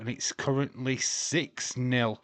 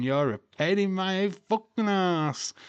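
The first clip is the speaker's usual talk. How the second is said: reading chat line; 8 semitones higher, 4 dB louder than usual. Retold or imitated. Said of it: "says James Chadwick".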